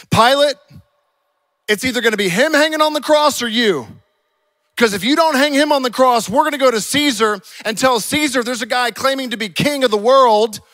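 high, loud ultimatum; shouted threat. Acted. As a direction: threaten him at full preaching volume.

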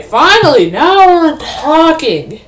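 loud, high and drawn out slowly; excited cheer. Real, not acted. Gamer cheering as the action finally starts, loud and slow. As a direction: savour the moment, nearly singing it. Finally found fight in game.

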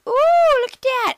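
high squealing delight; excited squeal. Real, not acted. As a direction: gush over the discovery. Kid excited at find in game.

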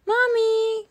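high, loud cry for Mommy. Acted. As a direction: call out for help. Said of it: "cried Peppa".

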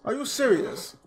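high, loud indignant yelling; outraged disbelief. Real, not acted. Streamer yelling back at a rude NPC, high indignant shout. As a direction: shout back, stunned and offended. Game character insults him.

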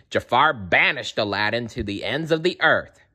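ordinary delivery

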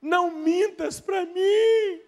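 high, slow, crying plea; a woman's tearful plea, high and slow. Acted. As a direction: beg through tears not to be lied to. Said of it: "O que que ela fez?".

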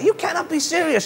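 high incredulous mock shout at the umpire; hammed-up disbelief; McEnroe shouting. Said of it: "shouting at the umpire".

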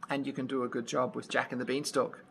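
ordinary delivery

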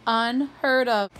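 drawn-out "unheard of" in mock outrage; a grandmother's prim indignation; indignant. A narrator's reading. Nana indignant at no candles.

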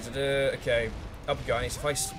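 normal talking voice

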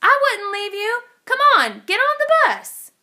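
high, loud call from the bus; yelled out the window. A narrator's reading. Bus driver calling to George from the bus, loud high yell. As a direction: shout it out the window, cheerful. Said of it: "yelled out the window".

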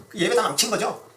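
ordinary talk

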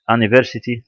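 questioning "university" as "ah-niversity", no "y" sound; mock-check the wrong "university", opening on "ah"; نطق خاطئ. Shown as a demonstration. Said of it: "لا، a university". (نطق "university" غلط بصوت "آ" كأنه سؤال)

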